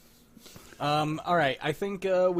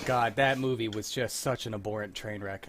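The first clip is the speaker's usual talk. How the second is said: normal talking voice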